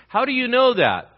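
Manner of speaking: high, loud pointed question; a sudden loud probing question; loud challenge. Retold or imitated. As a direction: challenge him sharply. Dialogue, challenging the believer.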